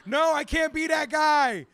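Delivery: loud, high, drawn-out shout; horrified refusal. Retold or imitated. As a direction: retell it as a panicked protest at full volume.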